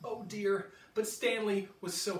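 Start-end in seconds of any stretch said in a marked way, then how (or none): none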